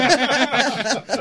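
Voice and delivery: a big burst of real laughter. Real, not acted. Laughs at his own story.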